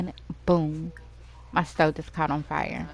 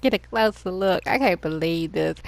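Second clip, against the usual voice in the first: usual speaking voice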